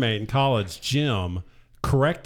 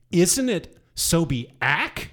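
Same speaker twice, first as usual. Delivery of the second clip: slow, higher, incredulous question; retold shock; 5 semitones higher, much slower than usual. Son asking dad about their own name, acted shock. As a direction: stretch it out in acted disbelief. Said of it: "I'm truly shocked".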